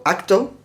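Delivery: "acto" ending in a clear Spanish O; wrong O ending. Shown as a demonstration. Teacher warns what is no English word, "acto" with an O ending. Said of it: "va a sonar mejor que la O".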